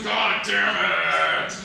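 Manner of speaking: slow, drawn-out grunted curse; straining gorilla. Acted. Gorilla on a T-shirt doing curls, straining curse. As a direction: strain it out like lifting a heavy weight. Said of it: "it's a gorilla doing curls going".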